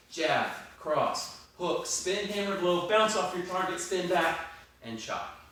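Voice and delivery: high, punchy combo calls; boxing coach calling combos. Shown as a demonstration. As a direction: call each strike to the class. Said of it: "instructor calling a drill combo".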